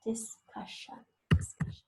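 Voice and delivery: whispering discussion softly twice; soft whisper while students write; whispered repeat. Shown as a demonstration. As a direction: breathe the word discussion out with no voice. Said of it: "teacher whispers word for spelling".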